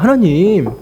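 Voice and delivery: imitating an aggrieved protest; resentful complaint. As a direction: plead and grumble, dragging the last syllables. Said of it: "또다시 원망하는 거예요".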